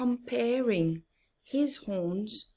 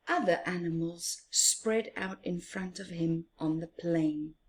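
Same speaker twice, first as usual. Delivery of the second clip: ordinary delivery, nothing marked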